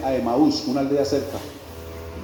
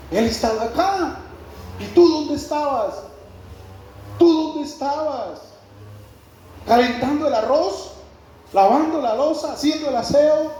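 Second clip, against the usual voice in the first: fiery preaching; 9 semitones higher than usual. Acted. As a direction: preach it hard, challenging each listener. Pastor preaching at full force.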